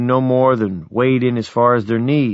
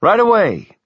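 eager; 11 semitones higher, 5 dB louder than usual. Acted. Eager reply to good news.

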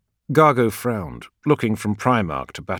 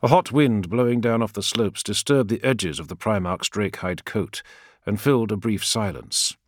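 ordinary delivery

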